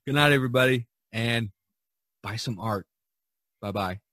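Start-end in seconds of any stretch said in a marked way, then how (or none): none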